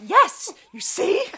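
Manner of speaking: high, loud, slow triumphant yes; triumphant I-told-you-so. Retold or imitated. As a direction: crow a gleeful told-you-so. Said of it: "So I was like".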